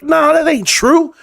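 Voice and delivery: slow, high scoffing nah; mock-calm dismissal drawn out high; dismissive retort. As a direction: brush it off slowly. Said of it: "And I'm like".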